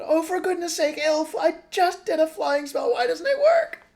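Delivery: very high, loud frantic yell; full-volume panicked shriek; bellowing. A narrator's reading. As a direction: bellow in desperate exasperation mid-fall. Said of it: "She bellowed".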